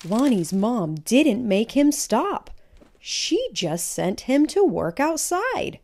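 lively picture-book read-aloud; reading to children. Acted. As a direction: tell it like a storybook to a class.

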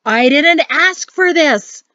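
loud, high, slow bellow; held-down bellow. Acted. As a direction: bellow it, barely holding back. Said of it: "He tried to keep his bellow down".

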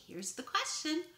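usual speaking voice

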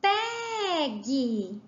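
"Pegue" slow, PE stretched long; stress on PE. Shown as a demonstration. Teacher showing the tonic syllable, PE drawn out in "Pegue". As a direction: hold the first syllable of "Pegue". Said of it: "é o pé".

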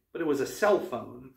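usual speaking voice

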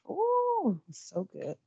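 high delighted ooh, then a soft savoring so good. Real, not acted. Tasting her quinoa for the viewers, delighted and savoring.

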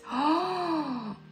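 high, sharp gasp of delight; excited gasp. Real, not acted. Fan realizing the voice actor fits, delighted gasp.

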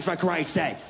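ordinary delivery

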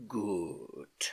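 low, yawned-out word; yawning. A narrator's reading. Sleepy uncle half listening to his niece, yawning. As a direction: say it through a drowsy yawn. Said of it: "he yawned".

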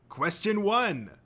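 high, drawn-out show announcer; quizmaster. A narrator's reading. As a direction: announce it grandly to the crowd.

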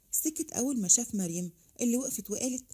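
plain reading voice

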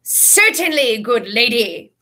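loud, high gallant knight voice; hearty knight. A narrator's reading. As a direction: declare it like an old knight. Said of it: "cried Sir Cadogan".

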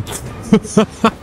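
breathy nervous chuckle after a scare; nervous laugh. Real, not acted. Streamer scared of the boss, nervous breathy laugh. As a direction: laugh off the fear under your breath.

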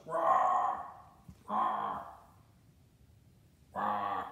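loud growling roars; playful lion roar. Pretend. Grandpa roaring at the grandchild, playful growl.

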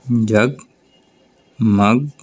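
very slow, split into its parts; teacher breaking a word into its pieces; syllable by syllable. Shown as a demonstration. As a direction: break the word apart as you say it. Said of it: "आहट, ठीक है".

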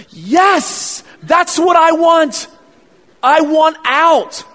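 loud, slow shouted protest; defiant kid yell. Retold or imitated. Child shouting back at the nurses to get out, loud.

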